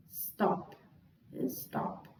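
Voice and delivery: whispered to oneself, testing the word; barely voiced, unsure murmur; under the breath. Real, not acted. Tests the syllables to herself.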